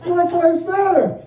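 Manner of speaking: wife gushing over the takeout label; delighted repeat. Retold or imitated. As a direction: savor it again with a laugh. Repeated, laughing.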